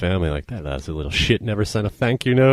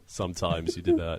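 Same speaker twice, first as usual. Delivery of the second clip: ordinary talk, nothing marked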